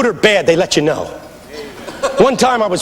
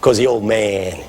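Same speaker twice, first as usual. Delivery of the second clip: low, slow-paused elder's voice; imitated old pastor; 9 semitones lower, 4 dB quieter, slower than usual. Old pastor answers the young preacher's challenge, low and assured. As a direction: lower and softer, quietly confident. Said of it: "my pastor squeezed my knee".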